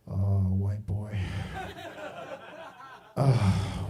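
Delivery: very low, slow street-guy warning; deep menacing stranger. Retold or imitated. Stranger warning the new guy off his street, low and slow. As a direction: drop way down and drawl the threat.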